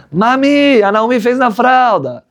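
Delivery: put-on high, slow little-kid report; child calling mom. Child telling mother baby soiled diaper, high and slow. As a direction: tattle to mom like a toddler.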